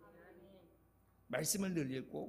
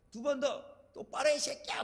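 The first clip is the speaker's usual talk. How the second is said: higher-pitched, harsh coaching order; rough coach; 7 semitones higher than usual. Acted. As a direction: order athletes around roughly. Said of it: "거칠어지죠". (거칠게 다그치는 코치 말투)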